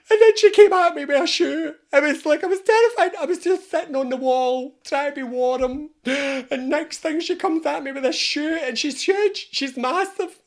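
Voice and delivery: very high, loud, hammed-up terror; hysterical spider. Spider telling its support group of a shoe attack, comic hysteria. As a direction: wail it like a traumatised little creature.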